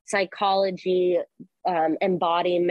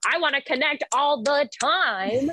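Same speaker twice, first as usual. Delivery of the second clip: anxious clingy voice; 7 semitones higher than usual. Retold or imitated. Voicing the anxious attached person.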